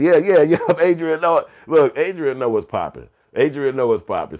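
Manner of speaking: loud, high laughing shout-out; excited hype. Real, not acted. Host hyping a chatter who got it right, loud and excited.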